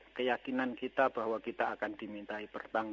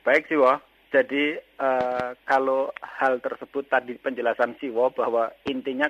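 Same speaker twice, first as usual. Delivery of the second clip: speaking up, much louder; raised voice; 2 semitones higher, 8 dB louder than usual. Real, not acted. Caller restating his question after being asked, raised voice. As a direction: project down the phone line on request. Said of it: "bisa agak sedikit keras suaranya".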